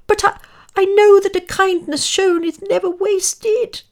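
loud, very high squeak; earnest mouse. A narrator's reading. Mouse pleads that kindness pays, high squeak. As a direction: insist in a piping little mouse voice.

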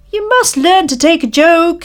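high shout thrown after them; taunting shout. Acted. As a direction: call it after the departing villagers. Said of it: "he shouted after them".

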